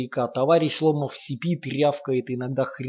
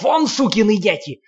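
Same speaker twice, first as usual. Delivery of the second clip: much higher, louder cursing roar; furious yell; 8 semitones higher, 7 dB louder than usual. A narrator's reading. Lomov cursing the children, furious yell. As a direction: spit the curse at them full force. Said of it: "орёт на них Ломов".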